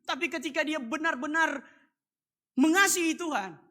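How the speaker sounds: loud, high preaching climax; fervent sermon peak at full voice; full-force preaching. Acted. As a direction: proclaim it to the whole church.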